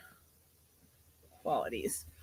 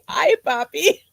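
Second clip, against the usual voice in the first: mock flirty greeting, pitched up and louder; teasing imitation; 5 semitones higher, 5 dB louder, slower than usual. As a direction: playfully put on someone else's greeting. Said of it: "You're gonna say".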